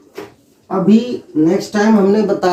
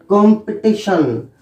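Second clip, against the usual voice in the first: usual speaking voice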